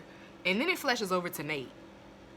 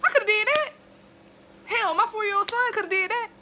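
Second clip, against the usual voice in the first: high, loud incredulous rant; outraged rant; 9 dB louder than usual. Real, not acted. Vlogger ranting about the actress, loud high outrage. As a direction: scoff in loud disbelief.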